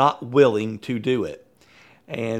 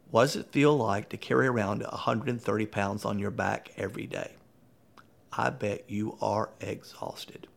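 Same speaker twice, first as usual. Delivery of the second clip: quiet, tender; 3 semitones lower, 7 dB quieter than usual. Retold or imitated. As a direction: lower the voice and reach her kindly. Coach softens to reach the manager.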